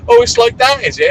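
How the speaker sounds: high, loud huffy retort; high loud mock-offended imitation; indignant mimic. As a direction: play the offended one. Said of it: "they usually go like".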